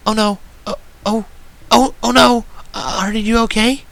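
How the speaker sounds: a timid pony panicking sweetly; tender Fluttershy voice. A narrator's reading. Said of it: "I suddenly heard in a very tender voice".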